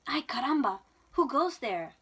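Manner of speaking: high, loud exclamation with Spanish flavour; startled challenge. A narrator's reading. Kitten meets a band of Chihuahuas, calling out startled. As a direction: call out boldly to the strangers.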